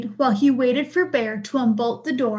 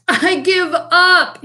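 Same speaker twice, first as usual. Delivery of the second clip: high, loud, drawn-out wail; blubbering; 6 semitones higher, 5 dB louder, slower than usual. A narrator's reading. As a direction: wail it like a defeated bear. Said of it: "he blubbered".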